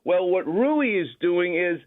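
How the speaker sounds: usual speaking voice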